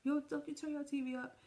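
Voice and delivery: high-pitched scolding yell; a sister yelling a warning, loud and high. Retold or imitated. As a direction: holler at her to stop. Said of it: "his sister was like".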